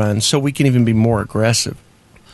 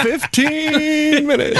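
high, drawn out through laughter; punchline stretched out in a high laugh; 14 semitones higher, much slower than usual. Real, not acted. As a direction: squeal it slowly while cracking up. Cracking up at own punchline.

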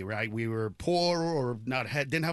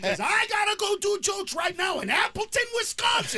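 high, loud furious mock rant; exasperated dad yelling at his anxious son; snapping, yelling dad; 5 dB louder than usual. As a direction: scream it at your kid in the car. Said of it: "And I just snapped".

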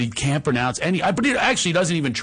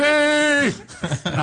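ordinary delivery

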